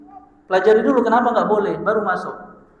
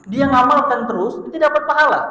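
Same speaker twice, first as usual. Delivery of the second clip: excited emphasis; 7 semitones higher, 4 dB louder than usual. Real, not acted. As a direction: voice jumps high on the reward. Preacher stresses reward while asleep.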